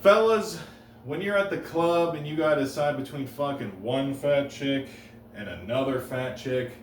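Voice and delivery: ordinary talk, nothing marked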